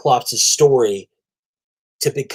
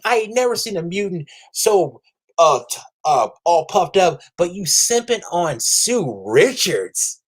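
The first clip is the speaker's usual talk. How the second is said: higher, building into a mocking shriek; trash talk; 6 semitones higher than usual. Trash-talking Namor, building to a mocking high shout. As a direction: roast him, rising to a squeal. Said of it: "I still would have been talking trash".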